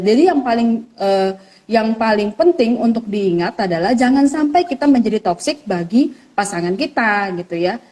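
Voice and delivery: ordinary delivery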